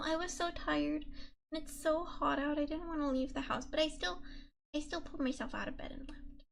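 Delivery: ordinary talk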